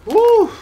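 loud high whoop; triumphant whoop. Real, not acted. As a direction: let out a big whoop. Finishing a hard set.

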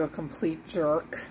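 normal talking voice

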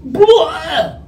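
high, loud cartoon retch; mock vomiting noise. Fish throwing Jonah up, loud mock retch. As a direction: spew it out in one big burst. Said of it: "And then the fish goes".

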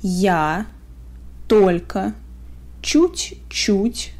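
ordinary talk, nothing marked

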